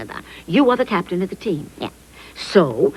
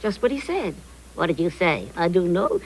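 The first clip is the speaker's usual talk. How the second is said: ordinary talk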